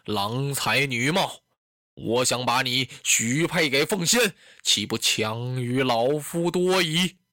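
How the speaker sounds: low, measured voice of an aging warlord; deep-pitched lord reasoning with his concubine; old lord conceding. Acted. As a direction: play a resigned old master, pitched well down. Voices Dong Zhuo consoling Diaochan.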